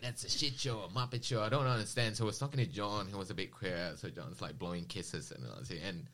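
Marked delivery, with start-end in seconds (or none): 0.0-1.4 lightly imitated Aussie heckler, higher; Aussie accent. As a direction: mimic an Aussie stranger's remark. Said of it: "His Aussie accent was pretty".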